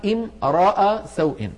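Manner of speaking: slow, high "امرأ" with "a" after the r; ra follows next vowel. Shown as a demonstration. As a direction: draw out "امرأ" as "imra'a", ra with fatha. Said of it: "والراء تتبع هنا حركة ما بعدها". (نطق «امرأ» بفتح الراء ببطء)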